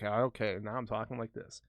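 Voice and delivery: ordinary delivery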